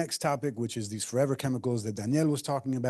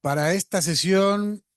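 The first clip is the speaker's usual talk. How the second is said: lucha libre announcer; 4 semitones higher, 5 dB louder than usual. Pretend. Grand opening call to audience.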